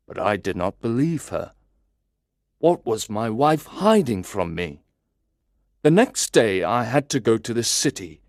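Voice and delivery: ordinary delivery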